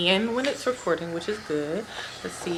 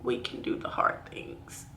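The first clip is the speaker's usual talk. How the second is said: low, soft, slow whisper through tears; tearful whisper; 8 semitones lower, 7 dB quieter than usual. Real, not acted. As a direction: barely hold it together. Grieving, breathy near-whisper.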